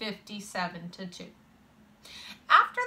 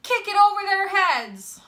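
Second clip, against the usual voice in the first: high, loud coaching call; shouted encouragement; 10 semitones higher, 7 dB louder than usual. A narrator's reading. Boy urging teammate at the plate, loud high shout. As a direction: yell it to urge him on. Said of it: "Lewis shouted".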